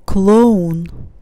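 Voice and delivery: "Clone" with a full, loud stressed O. Shown as a demonstration. Teacher shows learners a stressed O, "Clone" said with full O. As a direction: hit the O in "Clone" as a clear Russian O. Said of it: "Here it's stressed. I pronounce it as O".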